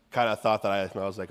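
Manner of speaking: normal talking voice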